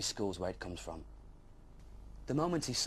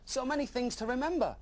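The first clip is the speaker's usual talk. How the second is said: mock overwhelmed; 14 semitones higher than usual. Dragon comic exclamation.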